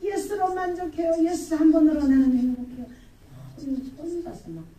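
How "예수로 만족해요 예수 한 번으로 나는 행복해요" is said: loud, happy proclamation; retold joyful shout. Believer telling everyone Jesus is enough, joyful shout. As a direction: re-enact shouting out contentment. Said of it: "막 소리 지르고".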